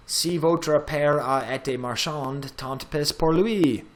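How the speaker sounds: slow, careful French line in a poor accent; bad French accent. Shown as a demonstration. Teacher reading a student's Molière line aloud, bad French accent. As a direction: read French deliberately, with a clumsy foreign accent, lifting the end. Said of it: "that's the worst possible accent".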